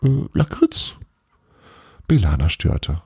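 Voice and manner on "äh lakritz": high, harmless-sounding question; hesitant, guileless little query; innocent. A narrator's reading. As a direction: ask it innocently, pitched up. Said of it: "fragte er ganz harmlos".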